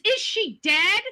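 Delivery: ordinary talk, nothing marked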